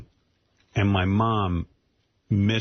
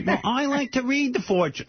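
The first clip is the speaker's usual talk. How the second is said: much higher, a mock little-old-mother voice; mother's voice; 14 semitones higher than usual. Daughter imitating her mother's defense, much higher. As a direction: pipe up like a meek mother. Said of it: "and my mother goes".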